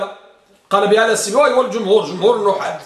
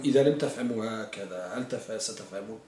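tired murmur; 12 semitones lower, 14 dB quieter than usual. Real, not acted. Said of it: "أنا مريض ومتعب".

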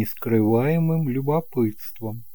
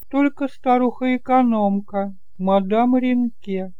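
put-on higher and louder, a fussing elderly aunt; chatty kind aunt; 12 semitones higher, 3 dB louder than usual. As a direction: play the doting aunt, bright and eager to please. Aunt answers Gilles.

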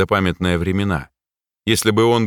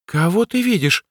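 put-on mouse girl voice; 11 semitones higher than usual. A narrator's reading. The mouse asks.

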